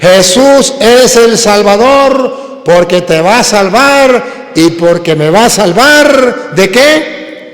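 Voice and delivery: loud, slow, high proclaiming; impassioned pulpit delivery; preaching at full force. Acted. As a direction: drive the verse home to the hall. Preacher calls out to the congregation.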